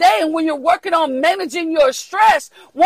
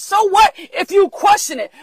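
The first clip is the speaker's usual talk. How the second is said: normal talking voice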